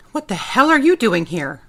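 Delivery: loud angry demand. Acted. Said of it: "I demanded".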